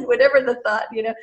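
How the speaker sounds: ordinary delivery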